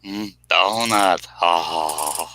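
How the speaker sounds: slow, low drooling voice; Homer impression. Homer drooling over a donut, slow and low. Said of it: "I can't do him either".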